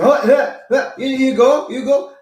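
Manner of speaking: high, loud mock mumbling old man; high put-on foreign-sounding old man; imitating old man. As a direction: play dumb like the guy. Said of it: "so he pretends like that".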